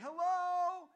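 high, loud frightened call; scared shout. Retold or imitated. Hearing a door open in an empty church, shouting scared. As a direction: shout hello, spooked. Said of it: "So I'm like".

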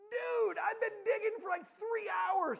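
high, loud outburst of complaint; indignant shout, stretched out at the start; exasperated yell. Retold or imitated. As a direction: vent at top volume, hammed-up. Retelling his exasperated answer to kid.

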